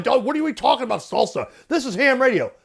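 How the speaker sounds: huffy complaint with a laugh in it; scoffing radio purist; mock yelling operator. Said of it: "They'll start yelling".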